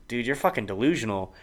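ordinary talk, nothing marked

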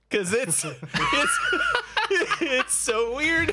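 high-pitched helpless laughter through words; laughing while talking. Real, not acted. Cracking up over weird movie.

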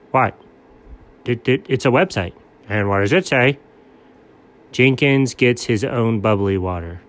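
usual speaking voice

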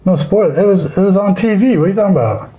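high indignant disbelief. Real, not acted. Indignant protest about spoilers.

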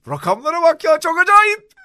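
high, loud amazed exclamation; surprised. Real, not acted. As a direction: blurt out in disbelief. Shocked by the numbers. (şaşkın ve yüksek sesle)

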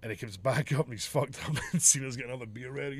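ordinary delivery

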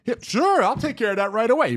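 high, bright fake-eager reply; a high, hammed-up sure-thing barber; mock eager barber. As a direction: chirp it like a too-eager barber. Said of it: "you're gonna be like".

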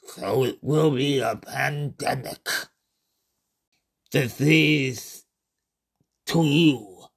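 slow, calm otherworldly alien voice; alien being. Acted. Alien Kafu introducing itself to the human, slow and calm. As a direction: speak as a patient visitor from another dimension.

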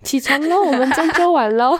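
high, lightly voiced coaxing nurse; nurse waking her. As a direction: gently wake a sleeping girl. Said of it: "护士小姐还走过来说".

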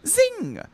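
high, sharp zing effect; high zing sound. Acted. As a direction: make the bullet zing. Sound effect in read story.

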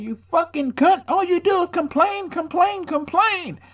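high, mocking nagging voice; mock someone whining; mocking whiny voice. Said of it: "You're like".

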